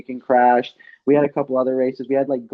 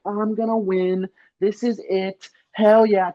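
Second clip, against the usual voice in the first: high-pitched retold thrill of victory; excited; 10 semitones higher than usual. Cyclist sensing a win in the last corner, excited.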